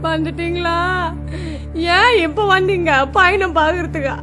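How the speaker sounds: tearful keening over a lost son; wailing lament. Acted. Mother wails over her dead son, tearful lament. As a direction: mourn aloud, voice breaking now and then.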